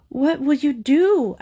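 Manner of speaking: high, slow, anxious question; mildly fretful storybook voice; faintly. A narrator's reading. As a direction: ask with a touch of dread. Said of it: "asked Missus Semicolon faintly".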